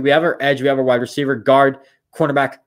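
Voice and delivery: usual speaking voice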